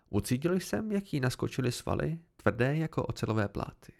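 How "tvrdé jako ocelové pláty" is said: plain reading voice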